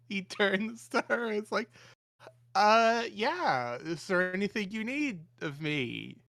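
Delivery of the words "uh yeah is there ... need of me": high, loud forced cheer through a frozen grin; rictus grin. Acted. Panicked assistant grinning at the bride, forced cheer. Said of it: "frozen in a nearly rictus grin".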